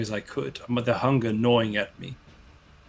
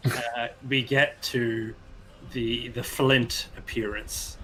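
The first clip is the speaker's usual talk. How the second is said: ordinary talk, nothing marked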